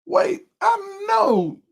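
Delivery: high, slow, squeaky wait I know; sudden realization. Retold or imitated. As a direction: drag it out like it just hit you. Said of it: "and be like".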